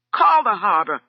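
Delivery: loud, high command; shouted. A narrator's reading. Husband calling for help during the fight, loud shout. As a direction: bellow the order. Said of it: "shouted Valerian".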